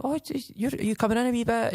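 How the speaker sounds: high, bright, mock-excited praise; high mock-delighted compliment; gushing compliment. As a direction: coo it like a delighted friend. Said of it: "I'm going".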